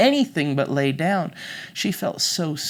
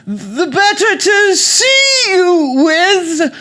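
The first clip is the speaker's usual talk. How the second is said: very high, slow, loud granny falsetto; fake British grandmother; 12 dB louder, much slower than usual. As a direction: coo it loudly as a disguised British granny. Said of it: "the voice from under the covers said".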